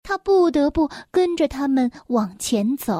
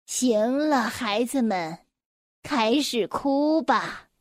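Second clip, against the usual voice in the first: witch voice; 3 dB quieter, slower than usual. Acted. Witch commands the cats.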